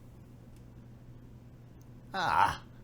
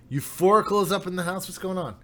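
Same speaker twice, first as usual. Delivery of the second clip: usual speaking voice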